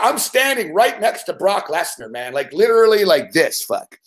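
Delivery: ordinary talk, nothing marked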